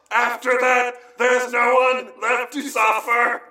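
high, sustained industrial-metal chant; hammed-up monotone rock yell.

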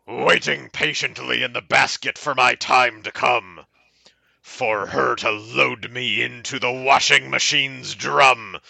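measured dramatic poetry reading; mock-earnest verse with pauses; poem recital. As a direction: recite it deliberately, phrase by phrase. Reads a poem aloud.

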